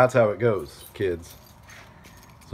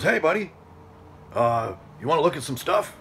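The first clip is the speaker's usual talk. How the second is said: lightly voiced neighbour, higher at the start; a casual, higher-pitched hail to a stranger, imitated; neighbour calling over; 6 semitones higher than usual. Neighbour calls over to him.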